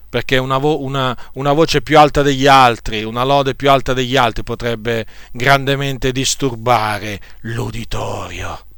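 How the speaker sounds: ordinary talk, nothing marked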